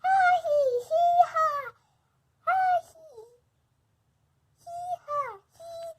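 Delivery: high, giddy ha-hee squeals of play; gleeful squeals. Acted. Playing happy sound game.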